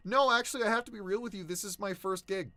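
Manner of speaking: nervous confession. Retold or imitated. Confessing to casting director, nervous.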